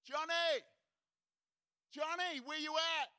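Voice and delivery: high, loud searching call; yelling out. Acted. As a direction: call across the countryside for someone lost. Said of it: "just yelling out".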